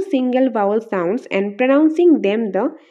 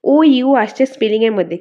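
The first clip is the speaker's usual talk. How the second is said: ordinary talk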